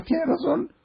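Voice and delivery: very high, sing-song "Tiene razón"; mock agreement. Mock agreeing with offended lady. ("tiene razón" burlón, muy agudo)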